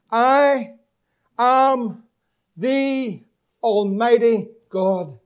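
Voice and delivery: slow, loud, weighty words of God; a booming divine announcement; majestic declaration. Acted. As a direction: proclaim "I am" word by word. Voicing God's declaration.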